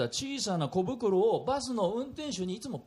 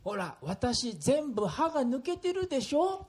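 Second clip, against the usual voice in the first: lightly voiced old lady, slow then higher; grandma voice; 7 semitones higher, slower than usual. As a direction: play a sweet elderly grandma. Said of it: "するとおばあちゃんこう答えました". (おばあちゃんの声)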